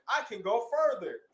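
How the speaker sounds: high, loud defiant brag; defiant boast. Acted. Storm girl ignoring her sister, defiant brag. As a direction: push past the limit.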